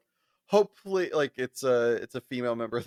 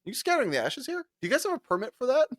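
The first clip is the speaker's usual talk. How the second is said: high, fast prying neighbor voice; pitched-up mock neighbor; nosy neighbor; 11 semitones higher, faster than usual. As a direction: play the busybody neighbor checking for permits. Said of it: "this neighbor comes by and goes".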